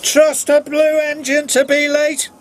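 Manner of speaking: high, scornful engine voice; mocking James. A narrator's reading. James taunting Edward as he puffs in. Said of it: "called James".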